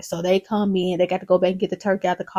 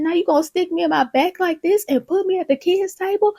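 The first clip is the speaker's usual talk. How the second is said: higher-pitched indignant protest; indignant mama; 9 semitones higher than usual. Retold or imitated. Mama protests being put at the kids' table, indignant. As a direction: act out an affronted complaint.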